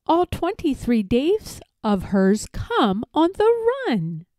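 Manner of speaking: usual speaking voice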